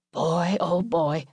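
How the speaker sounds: sigh, then slow exasperation; weary dad. A narrator's reading. Father shaking his head at his family, weary. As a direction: sigh and draw it out wearily.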